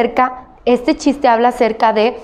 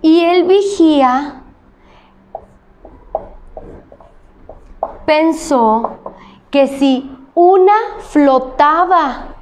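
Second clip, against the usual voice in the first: slow dictation; slower than usual. Shown as a demonstration. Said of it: "Lo voy a apuntar en el pintarrón".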